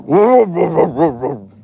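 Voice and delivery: loud mock dog bark; imitating a deep bark. Owner imitates her dog's night bark, loud mock bark. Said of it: "and it's like".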